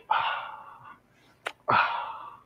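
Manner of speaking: breathless unvoiced grunts; grunting lifts. Gym guy lifting, unvoiced straining grunts. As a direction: mime straining under a heavy weight.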